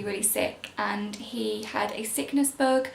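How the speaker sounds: ordinary delivery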